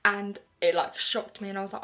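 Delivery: usual speaking voice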